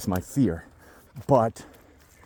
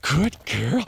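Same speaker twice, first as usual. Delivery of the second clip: ordinary talk, nothing marked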